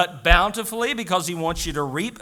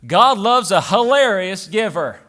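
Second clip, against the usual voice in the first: raised, higher preaching voice; full-force preaching; 7 semitones higher, 8 dB louder than usual. Real, not acted. Pastor urging cheerful giving from the heart, preaching hard. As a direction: ring it out to the congregation.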